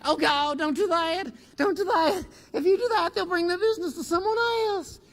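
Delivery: high, loud, frantic begging; panicked pleading. Retold or imitated. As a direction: plead like a scared manager begging him to stop. Managers panicking, pleading with boss.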